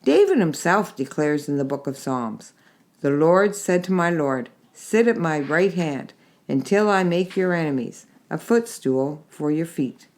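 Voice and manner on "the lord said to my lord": ordinary delivery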